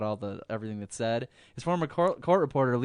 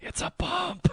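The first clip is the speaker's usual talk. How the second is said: high mock voice. Acted whisper quote, high.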